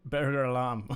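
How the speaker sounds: a light attempt at a Scottish burglar alarm; amateur Scottish accent on a dare. Shown as a demonstration. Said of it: "in the Scottish accent. Go".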